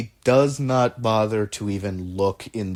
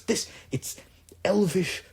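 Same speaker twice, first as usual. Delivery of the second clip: higher character voice, lilting and chuckling; enthusiastic patron; 6 semitones higher than usual. Acted. Patron handing elvish bread to the inventor, fondly enthusiastic. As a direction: proudly show off a wondrous gift.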